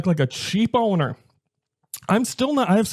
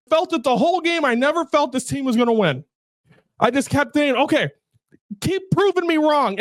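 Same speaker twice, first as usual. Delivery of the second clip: higher-pitched, worked-up venting; fed-up fan, pitch raised, ending in a shout; frustrated fan rant; 6 semitones higher than usual. Real, not acted. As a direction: rant at the team's losing, voice up high. Fan ranting after Bears loss.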